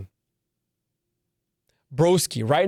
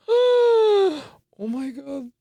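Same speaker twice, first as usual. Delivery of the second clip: gasp of excitement; 12 semitones higher, much slower than usual. Real, not acted. Imagining Messi's second World Cup.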